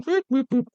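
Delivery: high, short vocal boops; tuning beeps. Pretend. Resetting the accent before reading, high mock tuning beeps. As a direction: imitate little tuning beeps in a high pitch. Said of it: "let me tone".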